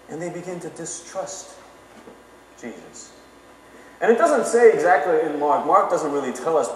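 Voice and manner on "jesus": usual speaking voice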